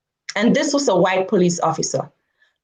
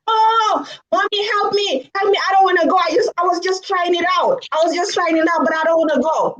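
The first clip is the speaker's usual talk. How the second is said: mock wail of a begging kid, much higher voice; panicked child pleading; 10 semitones higher, faster than usual. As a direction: imitate a frightened child crying out for mum. Frightened kid begs mother for help.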